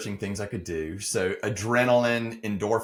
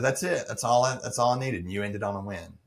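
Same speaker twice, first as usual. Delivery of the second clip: ordinary delivery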